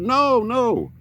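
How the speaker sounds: put-on high, insistent hillbilly old-timer. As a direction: refuse it like a stubborn old hillbilly. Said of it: "old man hillbilly voice".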